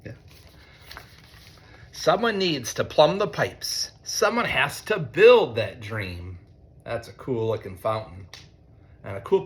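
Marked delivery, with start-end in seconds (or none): 1.9-6.4 slow, higher refrain, dropping on the dream; reading to children. A narrator's reading. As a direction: lean into the refrain slowly. Reads picture book to students.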